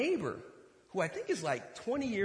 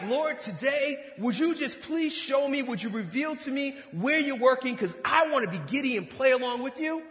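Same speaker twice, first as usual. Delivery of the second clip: eager prayer; 6 semitones higher, 5 dB louder than usual. Acted.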